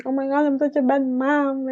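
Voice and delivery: mock victim mother. Mocking mom's victim talk.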